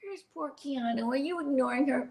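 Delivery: a hint of a fussing grandma; imitating Nana. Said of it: "She would go".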